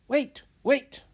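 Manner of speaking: high, loud shout of wait. Acted. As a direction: burst in loudly to stop them. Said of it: "shouted Antonia".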